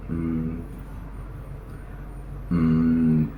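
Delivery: ordinary delivery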